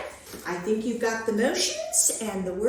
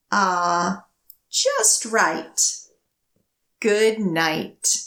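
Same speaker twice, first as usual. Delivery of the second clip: lively picture-book storytelling; reading to children; 6 dB louder, slower than usual. A narrator's reading. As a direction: read it to a room of little kids, bouncing the rhyme. Reads picture book aloud.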